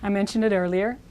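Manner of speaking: ordinary talk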